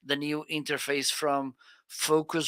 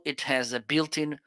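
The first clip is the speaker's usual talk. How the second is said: ordinary talk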